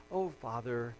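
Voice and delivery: low, soft imitation of a serene prayer; mock placid prayer. Preacher mimics Jesus praying calmly in the garden, low and subdued. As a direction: murmur it piously, as a mistaken picture. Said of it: "I don't think that's how Jesus was praying".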